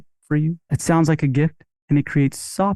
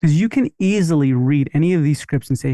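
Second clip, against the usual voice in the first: ordinary talk, nothing marked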